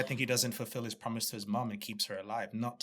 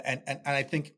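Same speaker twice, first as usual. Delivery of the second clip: ordinary talk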